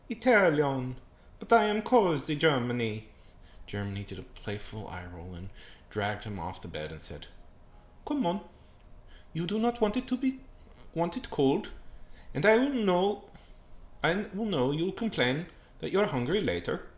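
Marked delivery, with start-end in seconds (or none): 0.1-3.1 louder put-on Italian accent. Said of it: "trying to do an Italian accent". 8.0-16.9 high, emphatic put-on character voice; scolding Germany voice. Germany scolds Italy in story.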